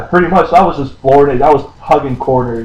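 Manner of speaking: usual speaking voice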